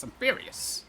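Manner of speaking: high whispered mock fury. Real, not acted. Gamer mutters his mock fury to himself in a high whisper. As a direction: hiss it under your breath.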